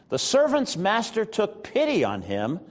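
normal talking voice

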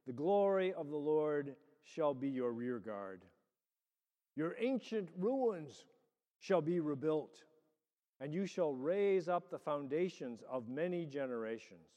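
ordinary talk, nothing marked

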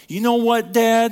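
loud, high, resentful blast at Dad; indignant outburst. Retold or imitated. Resentful older son shouting at his father, loud and high. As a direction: spit it out like a fed-up son snapping back. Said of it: "You ever said it like that to your dad?".

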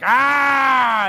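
loud, high mock dinosaur shriek; baby dinosaur scream. Dad imitating his baby son's loud, high scream. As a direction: screech like a tiny dinosaur. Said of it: "he screams at me like an adult sometimes, or a dinosaur".

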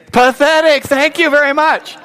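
high, ringing verdict; loud exclamation. Real, not acted. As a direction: call it out loudly to the crowd. Calling out verdict with congregation.

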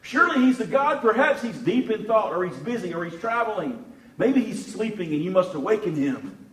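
high, loud mocking jeer; taunting. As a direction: Elijah jeering at the prophets of Baal. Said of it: "Elijah begins to taunt them".

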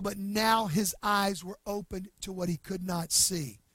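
usual speaking voice

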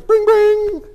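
high-pitched imitation of a ringing phone.

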